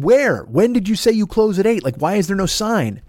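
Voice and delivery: retold mild yelling. Customers challenging the employee at the window, mock yelling. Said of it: "and they're yelling".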